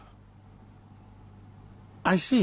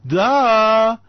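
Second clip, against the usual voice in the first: loud mocking duh; 6 semitones higher, 7 dB louder than usual. Counting one two three to viewers, loud mocking duh. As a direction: punch out a loud, higher "Duh!". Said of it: "Let's do a one, two, three".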